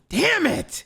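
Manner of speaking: high, sharp self-scolding; frustrated outburst. Real, not acted. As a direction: curse at your own mistake. Realizing he misread a name.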